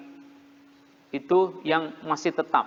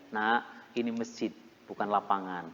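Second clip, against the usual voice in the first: put-on softer, lower, patient reminder to a child; gentle telling-off; 7 semitones lower, 7 dB quieter than usual. Shown as a demonstration. Preacher showing how to gently tell a child in the mosque, softly. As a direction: calmly correct the kid, no shouting. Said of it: "dibilangin aja".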